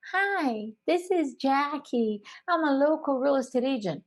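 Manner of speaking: slow, drawn-out telemarketer; slow speaking caller. Retold or imitated. Slow telemarketer drawling at an impatient homeowner. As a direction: drag the pitch out lazily. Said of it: "this slow speaking person".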